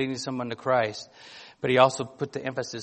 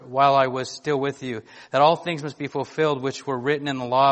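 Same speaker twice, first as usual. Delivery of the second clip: louder, measured reading of the Bible passage; scripture reading; 6 dB louder than usual. Acted. Pastor reading scripture to his church, louder and solemn. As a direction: read the Gospel out to the congregation with weight. Preacher reads Luke passage aloud.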